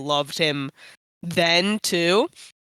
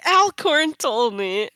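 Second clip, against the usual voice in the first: tattling; 9 semitones higher, 3 dB louder, slower than usual. Retold or imitated. Answering the pranksters.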